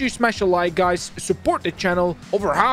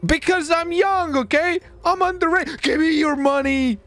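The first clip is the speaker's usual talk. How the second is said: pitched way up and slowed, playing a kid; mock kid voice; 11 semitones higher than usual. Robber to car saleswoman, claiming to be underage, in a put-on high kid voice. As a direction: whine it like a kid pulling a stickup.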